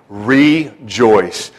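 "Rejoice" in two drawn-out syllables, RE then joice; "Rejoice" stretched out, prefix set apart; syllables split apart. Shown as a demonstration. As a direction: separate the RE in "Rejoice", low and deliberate. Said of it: "that word re, the prefix just means again".

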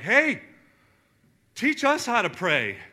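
loud, high, eager request; eager disciples. Retold or imitated. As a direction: call out to him, keen to learn. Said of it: "the disciples are like".

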